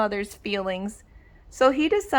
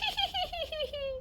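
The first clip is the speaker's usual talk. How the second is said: mocking dog laughter; 13 dB quieter than usual. Dogs laughed and laughed.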